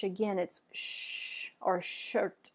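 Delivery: slow sh held in shirt; sh sound demo. Shown as a demonstration. Teacher repeats the sh sound. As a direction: draw out the sh. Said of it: "the C H in French".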